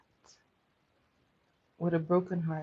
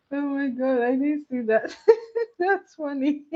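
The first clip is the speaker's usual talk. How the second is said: laughing; 8 semitones higher, 6 dB louder than usual. Real, not acted. Laughs at a chat comment.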